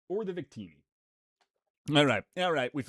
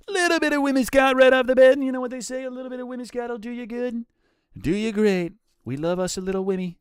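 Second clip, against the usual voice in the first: put-on higher-pitched Trump accent, starting loud; 10 semitones higher, 6 dB louder, faster than usual. Streamer riffing on a Whimsicott pull, in a Trump accent. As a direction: do a playful Trump impression. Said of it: "I said it in a Trump accent".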